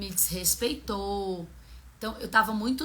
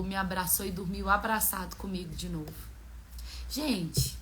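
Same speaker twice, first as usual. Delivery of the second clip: normal talking voice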